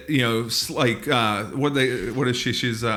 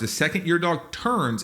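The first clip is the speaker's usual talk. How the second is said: ordinary talk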